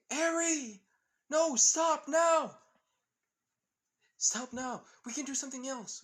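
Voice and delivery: high, loud, pleading yell; desperate shout. Acted. Ruby, a mother, screaming high and loud for her daughter to stop.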